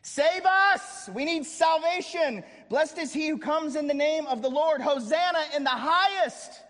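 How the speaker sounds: high, loud cry of praise; full-force preaching. Acted. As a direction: preach it out with everything you have.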